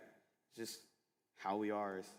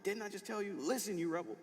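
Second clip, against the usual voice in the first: scolding mom; 11 semitones higher than usual. Retold or imitated. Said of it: "my mom comes in the room like".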